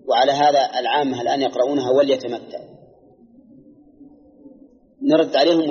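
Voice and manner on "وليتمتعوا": imitating the common reading of وليتمتعوا as "wal-yatamatta'u"; sukun on the lam. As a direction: say وليتمتعوا with no vowel after the lam. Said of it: "فقراءتهم إياها بالسكون". (محاكاة قراءة العامة «وليتمتعوا» بسكون اللام)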